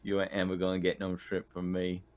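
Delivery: normal talking voice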